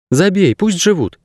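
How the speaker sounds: put-on high, dismissive young girl voice; girl's voice. A narrator's reading. Girl pushes dog aside.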